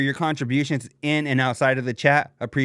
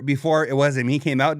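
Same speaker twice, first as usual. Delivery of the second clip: usual speaking voice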